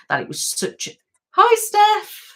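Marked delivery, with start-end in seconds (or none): 1.3-2.3 much higher and louder, a bright burst of hello; squealed welcome to a viewer; excited greeting. Real, not acted. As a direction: greet a friend who just arrived with delight.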